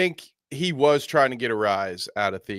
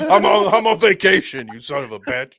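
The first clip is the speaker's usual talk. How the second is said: mock angry host; 8 semitones higher, 6 dB louder than usual. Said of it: "And he's like".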